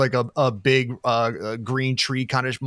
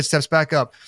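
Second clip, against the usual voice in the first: usual speaking voice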